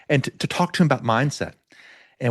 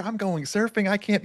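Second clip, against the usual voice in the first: much higher and softer, giddy; giddy excitement; 10 semitones higher, 3 dB quieter than usual. Retold or imitated. Heading for the water after the ankle fix, giddy and high. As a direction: squeak it out, too thrilled to wait. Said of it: "I'm like".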